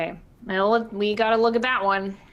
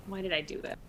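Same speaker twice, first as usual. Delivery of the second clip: very soft aside; hushed self-reproach; muttered regret; 2 semitones lower, 12 dB quieter, faster than usual. Acted. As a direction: grumble it under your breath. Regretful muttered aside under narration.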